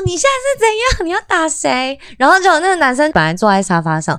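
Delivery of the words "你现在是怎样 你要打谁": high, mock-furious demand; retold angry confrontation. Kicking open his door to confront the boyfriend, mock fury. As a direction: confront him, squeaking with fake rage. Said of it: "超生气的".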